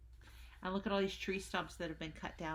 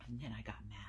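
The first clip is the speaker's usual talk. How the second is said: whispering; 7 semitones lower, 6 dB quieter, faster than usual. A narrator's reading. Hushed story narration.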